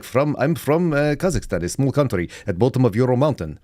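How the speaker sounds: put-on exchange student's broken English accent; fake Kazakh accent. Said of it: "I claimed to be a foreign exchange student".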